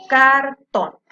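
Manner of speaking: "Cartón" said loudly as "car", then "tón"; split into syllables. Shown as a demonstration. Teacher repeating the separated word, "Cartón" in two loud syllables. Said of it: "Acá la separé en sílabas".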